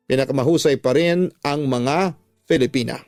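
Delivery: ordinary talk, nothing marked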